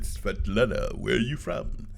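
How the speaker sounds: low, soft imitation of a Russian woman; Russian-accented English. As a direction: put on her sultry Russian accent, quietly. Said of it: "spoke that English with that accent".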